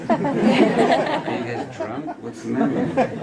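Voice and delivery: high, slow mock bafflement, laughing; puzzled onlookers. Cannes audience asking the laughing Danes if they're drunk, mock bafflement. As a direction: play baffled strangers, laughing. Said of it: "People were like".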